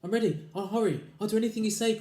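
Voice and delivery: high, loud eager pleading; crying wife. Acted. Wife crying out to her husband, frantic. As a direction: promise anything, frantic. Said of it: "cried Missus Twit".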